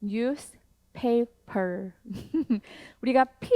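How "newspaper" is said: "newspaper" drawn out very slowly, every sound clear; "newspaper" spoken very slowly for learners; careful pronunciation. Shown as a demonstration. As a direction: stretch out "newspaper" syllable by syllable. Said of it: "newspaper이라는 발음 괜찮으신가요?".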